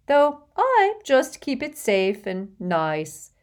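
put-on fussy, gushing rich old lady, laughing in; a vain old witch showing off to a charming boy; giggly hostess voice. Hepzibah showing the locket.

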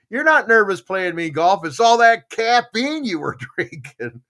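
teasing laugh. Retold or imitated. Golf buddy teasing him about the caffeine, laughing. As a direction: chuckle through the punchline. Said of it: "He goes".

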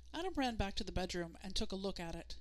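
ordinary delivery

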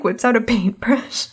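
normal talking voice

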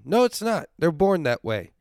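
ordinary talk, nothing marked

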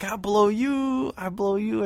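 high, stretched broken English. Retold or imitated. Clerk getting his threat wrong, mock broken English. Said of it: "he's got broken English".

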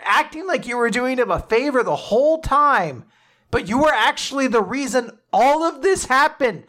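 high-pitched indignant tirade; furious Vegapunk. Retold or imitated. Vegapunk confronts Saturn over the experiment, furious. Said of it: "And Vegapunk's furious".

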